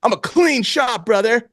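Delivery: slow, high put-on boast; mock tough guy. Would-be robber bragging to the clerk, mock tough. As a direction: draw out a hammed-up threat. Rehearsing a robbery threat, joking.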